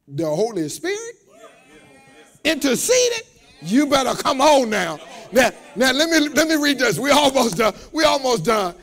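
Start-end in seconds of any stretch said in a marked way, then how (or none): 0.1-7.0 loud, high, shouting preacher's build; preaching. Acted. Preacher shouting to the congregation, full force.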